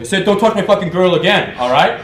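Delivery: loud, high, heated mock threat; imitated angry warning. Jealous boyfriend warning him off his girl, loudly angry.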